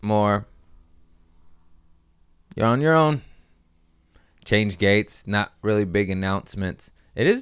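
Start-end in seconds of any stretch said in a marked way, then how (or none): 2.5-3.2 higher, blunt brush-off; mock curt dismissal. Airport staff telling travelers, a blunt brush-off. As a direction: toss it off like nobody cares. Voicing Mexico airport attitude.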